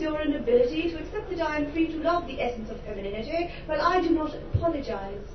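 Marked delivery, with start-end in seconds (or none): none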